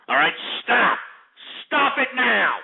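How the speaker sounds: angry shout. Real, not acted. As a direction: shout for them to stop. Losing patience with callers.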